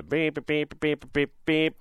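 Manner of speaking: string of high, short beeps; imitated censor beeps. Reading the seller's sweary text on air, beeping out curses. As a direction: bleep out swearing with your own voice.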